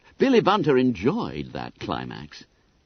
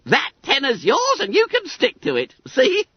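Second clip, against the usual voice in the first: high, squeaky schoolboy voice; self-satisfied Billy Bunter; 5 dB louder than usual. A narrator's reading. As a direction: pipe it up high, full of importance.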